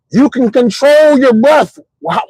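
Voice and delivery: usual speaking voice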